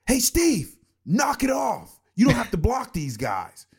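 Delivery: high, loud, angry shout at a teammate; furious corporate scolding; pissed-off corporate voice. Retold or imitated. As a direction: bark it like an irritated boss. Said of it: "in a pissed off, like, corporate voice".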